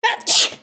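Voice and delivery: one sharp real sneeze; loud sudden achoo; sneezing. Real, not acted. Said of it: "I'm gonna sneeze".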